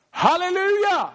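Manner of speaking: high, drawn-out, full-voiced halleluja; a stranger shouting halleluja across a silent red carpet; shouted hallelujah. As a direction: bellow it across the crowd like a revival cry. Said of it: "Han ropar så högt man kan".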